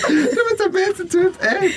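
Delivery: very high, teary laughing squeal; tearful, high laughing praise; crying with laughter. Real, not acted. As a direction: gasp it out, weeping with laughter.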